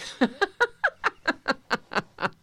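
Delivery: high rolling laugh. Shown as a demonstration. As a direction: keep the laugh growing. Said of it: "And a little louder".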